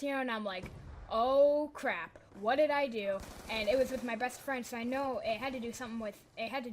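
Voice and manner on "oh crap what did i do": loud, drawn-out oh-no moment; slow, emphatic retold dismay. Student called to the principal's office, dismayed.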